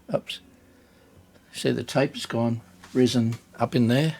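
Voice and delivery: usual speaking voice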